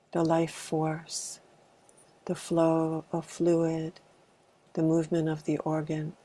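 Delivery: calm, unhurried meditation guidance with long pauses; spacious meditation leader's delivery; guided meditation. Acted. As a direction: gently guide a group into arriving, leaving space between phrases. Leads a body meditation.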